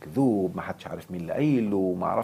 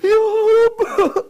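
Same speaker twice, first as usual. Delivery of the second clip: mock crying; 9 dB louder, much slower than usual. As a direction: fake weeping plea, very high and loud. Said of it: "يقول لك". (بكاء تمثيلي ساخر)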